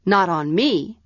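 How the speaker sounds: usual speaking voice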